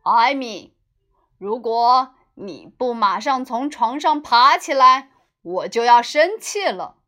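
loud, firm warning from a father; stern father voice. Acted. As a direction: lay down the law like a strict dad. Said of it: "用父亲严厉的口吻说道".

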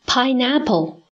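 slow, careful pineapple; teacher modelling pineapple slowly; slow model word. Shown as a demonstration. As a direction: spell out pineapple slowly for learners. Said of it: "在pineapple这个单词当中的I".

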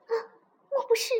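high, startled realization. A narrator's reading. Princess hears knocking at door, startled. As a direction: gasp out a dawning suspicion. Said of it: "小公主心里一惊".

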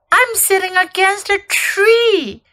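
high-pitched, astonished character voice; acted surprise, pitched well up; startled discovery. A narrator's reading. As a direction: blurt it out in amazement. Susan's startled discovery in story.

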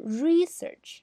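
research with a long, stressed REE; REE-search, front-stressed like the noun; noun stress on first syllable. Shown as a demonstration. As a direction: stretch the RE in research, very slowly. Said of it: "名词呢".

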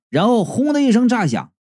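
ordinary delivery, nothing marked

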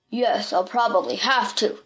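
lightly voiced story bully; a hint of a menacing villain. A narrator's reading. Villain answers in put-on voice.

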